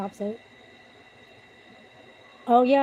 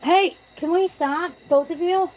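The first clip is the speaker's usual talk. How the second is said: slow, loud, much higher call; scolding cats; 9 semitones higher, 6 dB louder, slower than usual. Real, not acted. Telling her two fighting cats to stop, loud and high. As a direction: make them stop from across the room.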